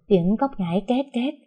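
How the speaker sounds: plain reading voice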